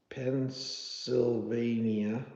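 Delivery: very slow, soft, syllable by syllable; drawn out while writing. Real, not acted. Host writing a viewer's state in the book, slowly and softly. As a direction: stretch the word out quietly as you write.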